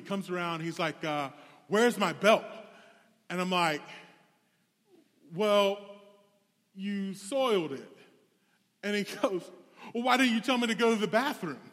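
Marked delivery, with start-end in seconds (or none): 1.7-2.4 louder, higher indignant question; demanding camper. Retold or imitated. Retold camper waking after seizure. 5.3-8.0 awkward retort. Retold or imitated. Retold awkward answer to camper. 9.9-11.6 high, loud, rushed complaint; indignant camper. Retold or imitated. Retold camper complains to counselor.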